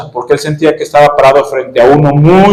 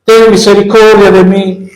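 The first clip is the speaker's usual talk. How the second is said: pleading cry; 5 semitones higher than usual. Retold or imitated. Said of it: "a gritos le decía".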